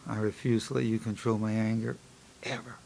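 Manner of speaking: low, soft, fading out on "ever"; exhale, then murmur the affirmation calmly; relaxed self-talk. Shown as a demonstration. Psychologist closing the breathing exercise, hushed and calm. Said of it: "Deep, get deep breath".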